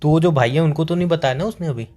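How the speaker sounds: normal talking voice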